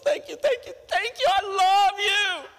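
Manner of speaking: put-on very high girlish gushing; gushing thanks. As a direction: gush like an overjoyed bride-to-be. Acted fiancée gushing thanks.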